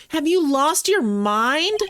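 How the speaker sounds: put-on high, scolding girl. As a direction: scold him, high and slow. Voicing the assistant.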